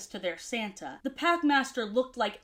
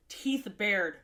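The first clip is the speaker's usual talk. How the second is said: ordinary delivery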